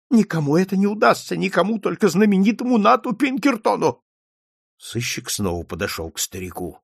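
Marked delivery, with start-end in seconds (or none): 0.1-4.0 high, agitated cry of faith; insistent old man. A narrator's reading. Old man counts on Pinkerton's protection, high and insistent. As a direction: insist on it in a raised voice.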